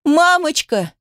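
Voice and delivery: high, panicked call for mother; frightened little girl crying out; sobbing child's cry. A narrator's reading. Daughter being pulled away from mother, desperate cry. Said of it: "Дочь захлёбывалась рыданиями".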